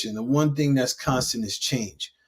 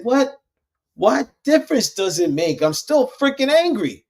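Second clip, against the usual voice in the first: high, louder, exasperated disbelief; indignant protest; 11 semitones higher, 4 dB louder than usual. Retold or imitated. [name] protesting to his coach, high and exasperated. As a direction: replay your past self, fed up and incredulous. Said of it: "I said".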